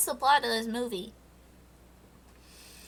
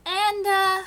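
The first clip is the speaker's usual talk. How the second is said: ordinary talk, nothing marked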